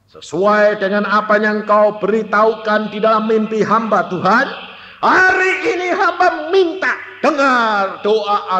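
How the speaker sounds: high, impassioned pleading prayer; retold fervent prayer. Child crying out to God for the parents, fervent prayer. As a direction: pray out loud, pitched high and insistent. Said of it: "Kita berdoa dengan tangisan".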